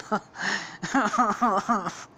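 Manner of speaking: high, helpless burst of laughter; can't hold the laugh in; laughing at own joke. Real, not acted. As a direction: crack up at your own joke. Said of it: "when even I laugh".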